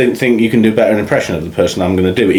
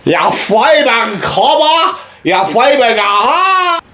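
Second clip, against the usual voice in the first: high, drawn-out Australian caricature; Australian accent; 13 semitones higher, much slower than usual. Shown as a demonstration. Host showing off his accent, hammed-up Australian accent. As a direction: screech it as a slow, hammed-up Aussie. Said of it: "Here's my Australian accent".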